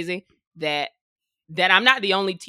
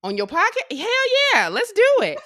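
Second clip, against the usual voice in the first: flattered guest; 10 semitones higher, 6 dB louder than usual. Retold or imitated. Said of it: "most of the time, people are flattered, like".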